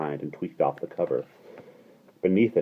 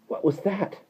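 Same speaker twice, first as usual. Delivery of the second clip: startled question. A narrator's reading. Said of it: "said Jane quickly".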